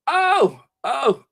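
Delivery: high excited squeal. Real, not acted. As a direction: yelp with delight. Excited find of photos.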